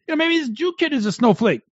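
ordinary talk, nothing marked

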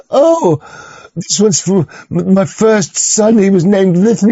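high, slow simpering mock voice; mock sentimental tattoo owner. Vlogger mimics a tattooed man, high slow simper. Mocking tattooed person's explanation.

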